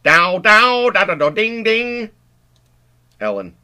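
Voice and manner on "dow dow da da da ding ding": high loud twangy guitar riff by mouth; electric guitar imitation. Stanley playing air guitar in the pipe, mouth guitar riff. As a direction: sing out a guitar lick. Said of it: "He imitates the sound of an electric guitar".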